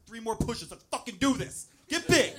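coach hype. Retold or imitated. Husband coaching wife through pushes, building hype yell. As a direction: pump her up like a spotter at the gym. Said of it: "I was like".

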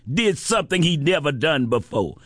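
ordinary talk

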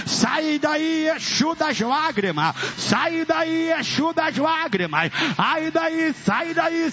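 high-pitched, relentless deliverance chant; exorcism preaching. Real, not acted. Pastor ordering Exu out of a person, full-force exorcism preaching.

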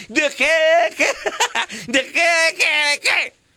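high mock echo with laugh; mocking drunk. Drunk mocking his wife while laughing, high. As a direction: parrot her, half laughing.

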